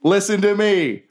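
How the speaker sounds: high-pitched goblin orator; rousing speech. Acted. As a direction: proclaim it high to the masses. Jerry gives goblins a rousing speech.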